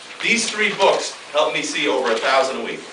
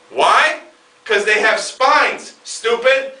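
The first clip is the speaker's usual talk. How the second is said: 1 exasperated retort; 7 semitones higher, 5 dB louder, much slower than usual. Acted. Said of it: "exasperated punchline".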